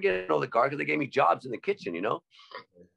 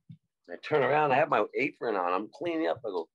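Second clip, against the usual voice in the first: ordinary talk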